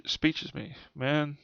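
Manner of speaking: ordinary delivery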